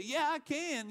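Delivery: high-pitched preacher's mock retort; defiant comeback. Preacher showing listeners how to answer naysayers, defiant. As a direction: answer the doubters back, pitch well up.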